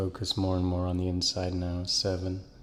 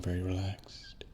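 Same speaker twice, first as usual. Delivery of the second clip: whispered; 8 dB quieter than usual. Acted. Soothing hypnosis, word drops to breath.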